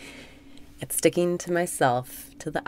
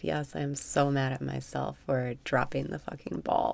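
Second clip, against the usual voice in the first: ordinary delivery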